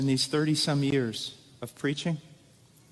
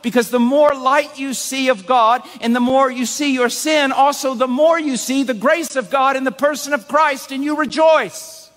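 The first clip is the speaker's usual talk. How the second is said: full-force preaching; 8 semitones higher, 7 dB louder than usual. Acted. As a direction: preach it to the rafters, building to joy.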